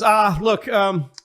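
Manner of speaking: high, stammering mock plea; frazzled man begging off a caller; harried staffer pleading. Staffer fending off a complaint call, high harried pleading. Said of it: "you could hear a loosened tie".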